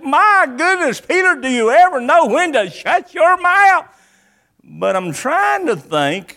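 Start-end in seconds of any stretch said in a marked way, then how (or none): 0.0-3.9 high, loud, exasperated reproach; fed-up outburst, pitched well up; exasperated scolding. Acted. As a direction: scold him in raised-pitch disbelief. Preacher acts exasperated at Peter.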